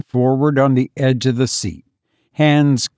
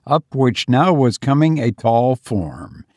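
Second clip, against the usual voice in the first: ordinary delivery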